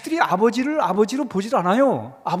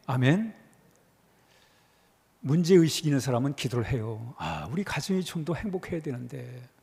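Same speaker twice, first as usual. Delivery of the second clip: quiet prayerful close; 9 semitones lower, 5 dB quieter than usual. Acted. Preaching turns to quiet prayer.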